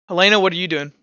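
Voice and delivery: normal talking voice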